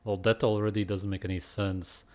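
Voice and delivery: ordinary delivery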